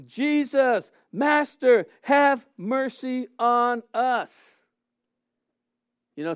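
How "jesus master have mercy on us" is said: raised, higher pleading call, drawn out at the end; lifted-up cry. Acted. Preacher voicing lepers calling to Jesus, lifted-up cry. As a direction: call out to someone far off. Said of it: "they lifted up their voice".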